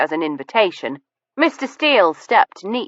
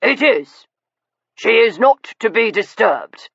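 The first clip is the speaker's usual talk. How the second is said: stiff gentleman; 5 semitones higher than usual. A narrator's reading. Sir Leicester protective.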